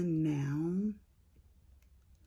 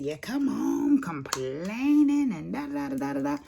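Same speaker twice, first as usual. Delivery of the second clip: higher mock grumbling, dragged out; hammed-up husband complaining; mad husband; 9 semitones higher than usual. Wife retells husband grumbling, higher mock mad voice. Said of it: "he was mad".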